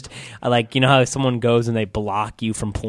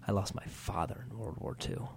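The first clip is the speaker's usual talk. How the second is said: grave teacher; 2 semitones lower, 14 dB quieter than usual. Retold or imitated. Teacher's grave reply, retold.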